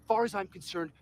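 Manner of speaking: usual speaking voice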